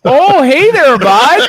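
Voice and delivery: high, loud hammed-up Canadian accent. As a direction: greet him like a cheery Canadian, bud. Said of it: "can you do the Canadian accent".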